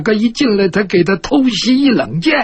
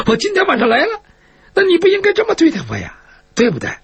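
much higher-pitched plaintive complaint; aggrieved protest; 10 semitones higher, faster than usual. Acted. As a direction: plead your case in a high voice. Captive protests his treatment at swordpoint. (高声委屈地抗议)

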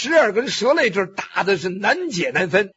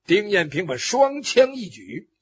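ordinary delivery

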